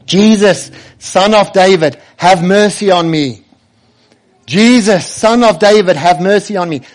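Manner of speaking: loud pleading cry; calling out for mercy. Retold or imitated. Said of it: "he shouts".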